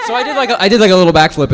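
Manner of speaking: high, loud, laughing excitement; excited laughing. Real, not acted. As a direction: burst out laughing with joy. Thrilled about getting the role.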